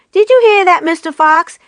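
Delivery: high, loud taunting shout; jeering yell. A narrator's reading. Bean shouting down the fox hole, jeering yell. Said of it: "yelled Bean".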